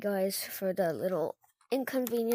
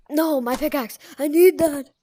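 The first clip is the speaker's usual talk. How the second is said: louder, urgent cries over the lost pickaxe; panicked about pickaxe; 9 dB louder than usual. Real, not acted. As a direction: plead to get the pickaxe back. Losing pickaxe in game.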